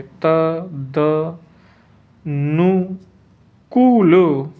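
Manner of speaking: तदनुकूल very slowly, each syllable drawn out; syllable-by-syllable dictation. Shown as a demonstration. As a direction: spell out तदनुकूल by sound, त-द-नु-कू-ल. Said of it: "तदनुकूल ऐसे लिखेंगे".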